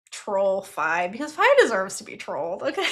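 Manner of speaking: ordinary talk, nothing marked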